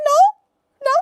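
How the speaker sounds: sudden loud, very high denial; hammed-up squealed protest; indignant denial. Caught crying by brother, indignant denial. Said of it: "And I'm like".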